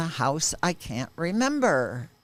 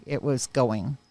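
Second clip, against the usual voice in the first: ordinary talk, nothing marked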